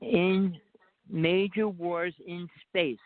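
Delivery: each word drawn out slowly; slow right down so he can follow; slow and clear. Real, not acted. Repeating for a caller who can't understand, slow and clear. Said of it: "I can't understand".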